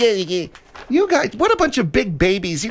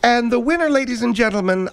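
ordinary talk

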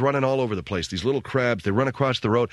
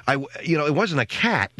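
normal talking voice

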